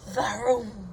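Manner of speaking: ordinary delivery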